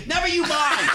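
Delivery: high, loud mock huff; indignant snap. Prank victim asked his age by caller, indignant snap.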